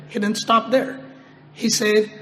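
usual speaking voice

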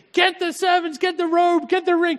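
very high, loud rapid-fire orders; so excited father. Acted. Father too excited to hear his son's apology, exuberant. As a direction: call out orders in overjoyed excitement. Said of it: "he was just so excited".

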